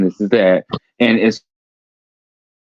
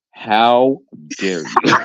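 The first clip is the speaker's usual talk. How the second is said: mock offended gasp; much slower than usual. Mock offended at friend's joke.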